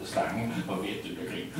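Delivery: ordinary delivery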